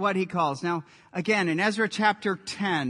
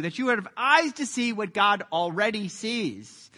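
usual speaking voice

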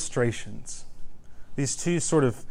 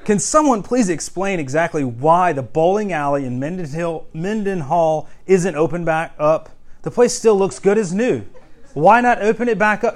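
loud, raised, exasperated complaint; mock rant; 4 semitones higher, 6 dB louder than usual. Preacher reading out a bowling alley complaint, mock indignant. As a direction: perform it as an indignant rant, building higher. Performing printed pirate rants.

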